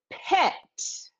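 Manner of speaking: "Pet" said crisply as a model; model pronunciation. Shown as a demonstration. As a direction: say "Pet" clearly for the learner. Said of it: "repeat and pronounce this word".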